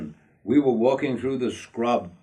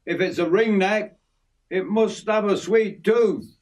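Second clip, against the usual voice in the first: raised, higher-pitched call; 9 semitones higher than usual. A narrator's reading. Narrator's friend calls out to Jim, calling out. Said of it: "I called out to Jim".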